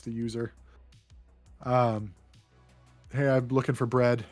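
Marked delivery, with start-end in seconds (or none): none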